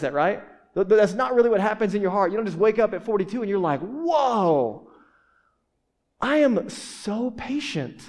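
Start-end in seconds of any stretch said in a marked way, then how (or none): 3.8-8.0 drawn-out smug "I am so patient"; mock self-satisfied. Mocking a churchgoer who wakes up patient. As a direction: gloat slowly like a self-satisfied saint. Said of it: "said no one ever".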